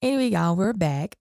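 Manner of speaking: normal talking voice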